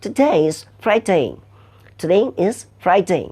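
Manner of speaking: slow British "today is Friday", crisp "day"; British accent. Shown as a demonstration. Teacher shows students the British way, slow British accent. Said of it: "ব্রিটিশরা বলছে".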